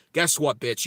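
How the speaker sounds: normal talking voice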